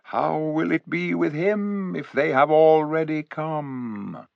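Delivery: a hint of a wise eagle; eagle's inner voice. A narrator's reading. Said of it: "thought Farsight".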